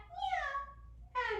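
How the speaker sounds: high little meow; cat meow. Shown as a demonstration. Teacher cueing children into cat pose, high cat meow. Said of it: "arch your back for cat".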